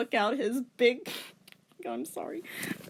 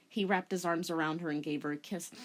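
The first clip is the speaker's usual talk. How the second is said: usual speaking voice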